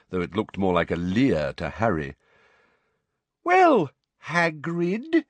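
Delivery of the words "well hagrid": high, drawn-out, patronizing address; loud, slow voice. A narrator's reading. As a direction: space it out as if to someone dim. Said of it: "that loud, slow voice".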